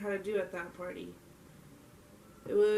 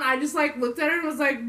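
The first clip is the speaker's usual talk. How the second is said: ordinary talk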